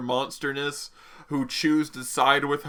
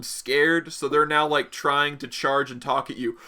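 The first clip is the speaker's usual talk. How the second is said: ordinary talk, nothing marked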